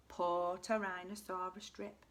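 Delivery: plain reading voice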